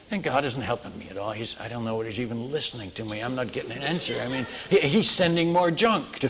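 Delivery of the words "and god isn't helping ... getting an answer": normal talking voice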